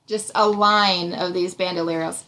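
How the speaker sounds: ordinary talk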